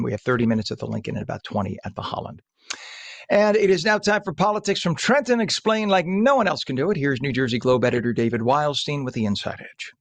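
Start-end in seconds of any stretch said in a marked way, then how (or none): 3.3-9.0 higher, show-intro announcer voice. Acted. Radio host introducing the politics segment, announcer style. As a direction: sell the segment like a promo.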